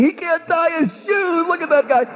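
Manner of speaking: mock the kid from the stands; mocking taunt. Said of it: "they're saying".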